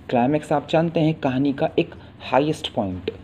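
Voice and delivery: usual speaking voice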